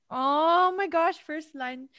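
high, slow, loud gasp of surprise; oh-my-gosh shock; surprised. Real, not acted. Reacting to the first line.

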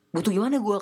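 genuinely confused, asking back; puzzled surprise. Retold or imitated. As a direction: pitch up in disbelief. Retold puzzled reply to friend.

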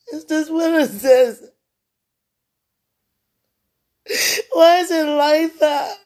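high, loud, slow sobbing question. Real, not acted. Grieving woman crying out to God. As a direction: wail it in despair.

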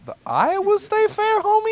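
high, slow squeaky disbelief; mock disbelief.

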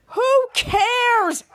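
high, loud impatient whine; mock exasperated cry; exasperated who cares. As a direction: brush it off loudly. Volt dismisses the corn.

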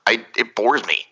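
ordinary delivery

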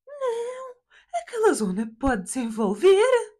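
high, drawn-out mock mother; mother's voice. Daughter imitates mother objecting to the sale, mother's voice. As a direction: stretch it out high, imitating mum. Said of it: "Que ela fala assim".

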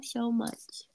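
soft breathy love whisper; tender whisper. Real, not acted. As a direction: whisper it softly to your wife. Tender end of love message.

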